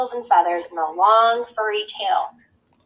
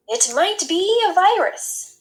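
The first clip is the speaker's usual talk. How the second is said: slow, high, lightly voiced expert doctor; a hint of a doctor pronouncing a diagnosis; announced with authority; 9 semitones higher, slower than usual. A narrator's reading. Doctor announcing a diagnosis to the experts, with authority. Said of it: "he announced with authority".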